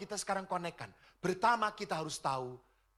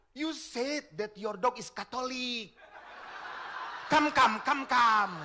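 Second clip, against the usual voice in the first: laughing, building to a loud come come; laughing tease; 6 semitones higher, 6 dB louder, slower than usual. Retold or imitated. Priest teasing the dog owner, laughing and louder. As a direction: tease her through laughter.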